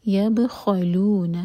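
یبخلون with the ب bounced and the خ rasped. Shown as a demonstration. Teacher models یبخلون for a student, slow and careful. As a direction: stretch out یبخلون slowly, giving the ب a little bounce. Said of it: "با کو ہم bounce کریں گے".

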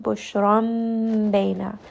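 very slow "Bushram bayna", the n turned to a held m; nasal m before ba; iqlab demo. Shown as a demonstration. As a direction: stretch "Bushram" and close the lips on the m before "bayna". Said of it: "we are going to say it with a meme".

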